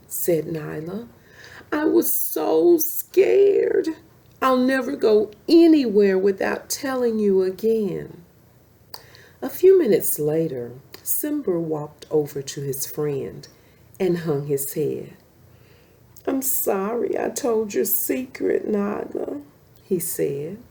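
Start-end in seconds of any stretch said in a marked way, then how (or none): 1.7-8.2 a frightened cub still trembling; scared cub. Acted. Rescued cub tells her mother. 16.3-19.4 higher, pleading cub voice; apologetic cub. Acted. Simba apologizing to Nyla, higher and pleading.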